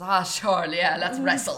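loud, game devil with laughter; boisterous acceptance. Acted. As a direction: take up the challenge loudly, half laughing. Drunk devil eagerly accepts the challenge.

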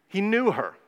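lightly archaic, biblical turn of phrase; a hint of solemn King James wording; mock King James phrasing. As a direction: say it like quoting old scripture, tongue in cheek. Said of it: "as the King James would say".

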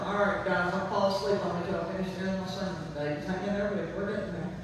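low, soft, droning monotone; mock a sleepy preacher dragging through his sermon; dull unemotional preacher. Pastor mocks a dull preacher to the church, soft droning monotone. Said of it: "If I wasn't emotional".

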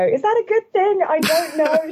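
high, self-questioning voice; doubting herself. Retold or imitated. Presenter imitating her own self-doubt after hearing recordings, high and unsure. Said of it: "suddenly go".

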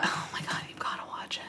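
breathy urgent whisper about the film. Real, not acted. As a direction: lean in and whisper it. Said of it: "unvoiced whisper".

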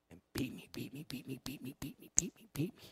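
rapid whispered chant; whispered repetition. Retold or imitated. Preacher showing how the coworker kept at him, rhythmic whisper. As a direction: say it over and over in a hushed, rhythmic whisper. Imitates being worn down by her.

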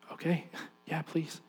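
very soft, hesitant acted reply; meek reply. Dad bracing for the worst from the woman he hurt, meek. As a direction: answer meekly, barely audible.

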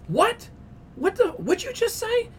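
high-pitched, indignant double take; affronted disbelief. Retold or imitated. Dog owner challenging the shopper who complained, affronted. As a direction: act out an offended man, pitch shooting up.

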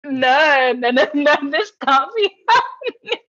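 high, loud wail of disbelief; exaggerated comic horror; mock panic. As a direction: re-enact despairing denial, hammed up. Re-enacting horror at being thrown out.

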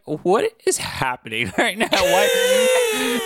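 baffled, breaking into laughter; incredulous, helplessly amused outburst; laughing disbelief. Retold or imitated. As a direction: end on a very high squeal of "why". Baffled reaction to absurd scene, laughing.

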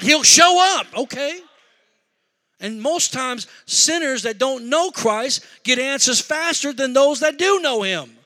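fiery preaching. Acted. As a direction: preach it at full force. Preaches at full force.

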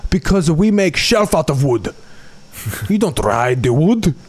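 lightly voiced Italian accent; a hint of Italian accent. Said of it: "I don't bother doing an Italian accent".

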